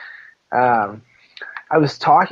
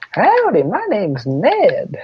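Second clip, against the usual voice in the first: goofy Ned voice; 8 semitones higher, much slower than usual. Retold or imitated. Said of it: "Yeah, like, like".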